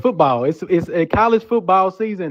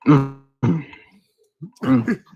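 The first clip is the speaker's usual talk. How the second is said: ordinary talk, nothing marked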